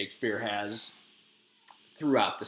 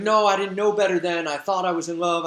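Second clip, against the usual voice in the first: higher, mock pleading excuse; imitated lover's objection, pitched up; defensive protest; 5 semitones higher, 4 dB louder than usual. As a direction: protest your innocence, lightly acted. Lysander protests, acted retelling.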